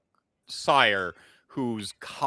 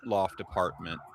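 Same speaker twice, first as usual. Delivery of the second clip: scene setting; 6 semitones lower than usual. Acted. Game master opening the session, setting the scene. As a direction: paint the room for the players unhurriedly.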